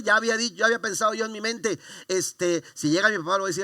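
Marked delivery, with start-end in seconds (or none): none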